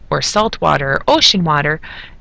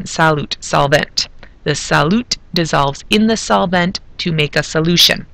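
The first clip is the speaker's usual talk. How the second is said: ordinary talk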